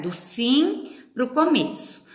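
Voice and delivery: ordinary talk, nothing marked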